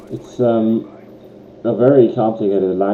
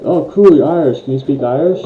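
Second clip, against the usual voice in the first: mock excitement, higher and louder; impressed foreigner; 6 semitones higher, 6 dB louder than usual. Foreigner meeting someone from Ireland, imitated as excitedly curious. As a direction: imitate an eager, impressed outsider. Voicing foreigners who meet Irish people.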